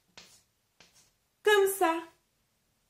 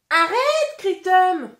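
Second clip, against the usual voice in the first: loud, high scolding cry; high-pitched, loud telling-off; shouted protest; 7 semitones higher, 7 dB louder than usual. Acted. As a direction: shout in alarm to make them stop. Acted scolding in story reading.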